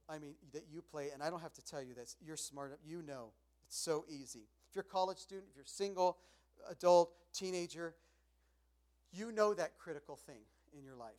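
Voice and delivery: usual speaking voice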